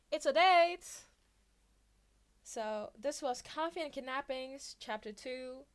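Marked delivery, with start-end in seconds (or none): 0.1-1.1 loud, bright exclamation; much louder and higher; gleeful. A narrator's reading. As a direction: crow it happily. Mike's gleeful reply.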